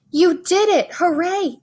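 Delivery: high, loud cry of joy; joyful cheer. Acted. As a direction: burst out cheering. Aunt cheers Rosie's flop.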